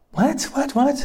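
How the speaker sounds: can't process it, voice jumps up; bewildered what. Retold or imitated. Boys handed plane tickets, squeaky bewildered whats. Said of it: "We're like".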